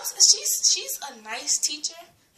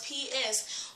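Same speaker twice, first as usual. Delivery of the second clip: slow spelling; much slower than usual. Shown as a demonstration. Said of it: "how to spell".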